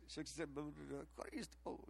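very soft imitation of muttered praying; hushed mock-mumbled prayer. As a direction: mumble nonsense under your breath, then the one word. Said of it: "he just kinda mumbled it".

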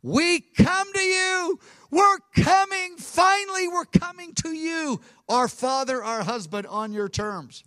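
high-pitched, loud pleading cry; crying out to God. Acted. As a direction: cry out as a repentant people finally coming home. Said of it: "So they cry out".

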